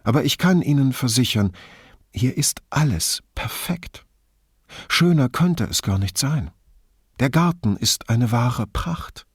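acted rapture, phrase by phrase; gushing admirer. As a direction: gush reassuringly, playing a part. Grifoni plays the admiring visitor.

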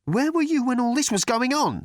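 high-pitched indignant demand. A narrator's reading. Arthur challenges Thor over chaos.

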